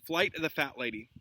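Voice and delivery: ordinary delivery, nothing marked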